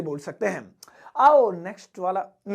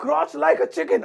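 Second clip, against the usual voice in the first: lifted up and louder, like an order; louder, higher repeat; 6 semitones higher, much slower than usual. Shown as a demonstration. Teacher repeats the phrase higher and louder for the class. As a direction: say it again higher and louder, as a command. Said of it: "इससे आप English में बोलेंगे".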